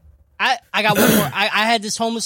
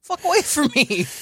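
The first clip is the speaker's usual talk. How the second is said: mock yell; 8 semitones higher than usual. Retelling yelling back at him.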